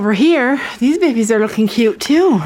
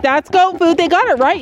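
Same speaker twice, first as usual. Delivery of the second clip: higher-pitched eager goat-food fan; animal's imagined voice; 7 semitones higher than usual. Pretend. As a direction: speak playfully for the animal, pitched up. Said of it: "And he's like".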